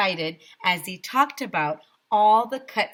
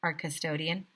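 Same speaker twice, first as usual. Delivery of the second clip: ordinary delivery, nothing marked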